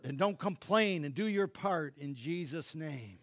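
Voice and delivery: a spoken prayer that bursts loud on be alert. Acted. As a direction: pray it out, then call them to wake. Prays over the nation.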